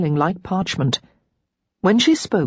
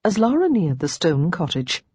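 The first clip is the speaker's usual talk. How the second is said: ordinary delivery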